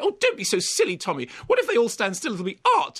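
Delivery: hammed-up Judi Dench, pleading sweetly; mock Judi Dench. Critic parodies Dench talking round the Lord Chamberlain, hammed-up. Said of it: "then she does the duet".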